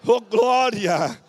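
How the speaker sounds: loud, high preacher's call to the flock; full-force preaching. Acted. Pastor exhorting the congregation, loud, full-force preaching. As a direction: proclaim it like a fiery sermon.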